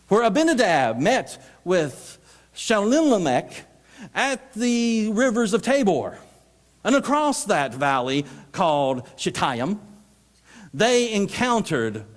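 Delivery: slow, high, halting reading; mock scripture reader. Unprepared reader handed the Old Testament, reading slow and halting. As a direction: labour through the hard biblical names, slowly.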